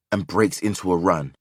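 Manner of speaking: ordinary delivery, nothing marked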